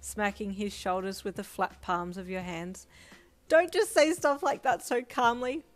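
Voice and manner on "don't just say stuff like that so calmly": high, loud embarrassed protest; much higher, louder playful telling-off; flustered scolding. Acted. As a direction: scold him through the blush.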